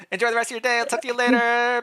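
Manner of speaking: chirpy princeling waving goodbye; cheerful farewell. Acted. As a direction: wave them off sunnily. Re-reading line, cheerful wave.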